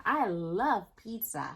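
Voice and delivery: plain reading voice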